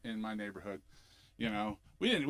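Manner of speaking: ordinary talk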